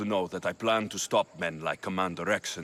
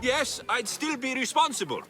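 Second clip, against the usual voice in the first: higher, lightly put-on voice of a loyal follower; pretend Cleon supporter; 13 semitones higher than usual. Posing as Cleon's supporter to help Socrates, higher and lightly put-on. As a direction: play the naive crowd member defending Cleon. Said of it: "respond as if you're one of Cleon's supporters".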